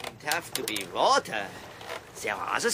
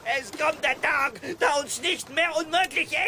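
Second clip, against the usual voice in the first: high, shouted proclamation; raving at a high pitch; manic ranting; 10 semitones higher than usual. Acted. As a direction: declaim it wildly over the protests. Raving on while told to stop.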